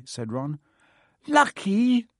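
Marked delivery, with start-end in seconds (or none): 1.3-2.1 high, louder indignant shriek. Acted. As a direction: shriek the word in disbelief. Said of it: "shrieked Hermione".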